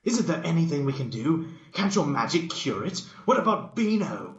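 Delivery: high, loud desperate plea; pleading. Acted. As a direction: mock-desperate pleading pony. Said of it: "pleaded Dash".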